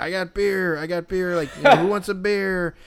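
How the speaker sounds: loud, high street hawking; barking vendor. Retold or imitated. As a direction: bark out the beer sale. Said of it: "He's, like, barking for these".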